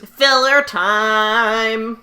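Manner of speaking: slow, drawn-out show-title announcement; announcer call. Acted. Hosting a recap, announcing the segment title slowly.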